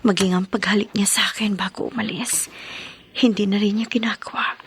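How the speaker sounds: hushed, breathy voice; whispered inner lament. Acted. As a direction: whisper the thoughts as if no one may hear. (pabulong na hinaing sa sarili)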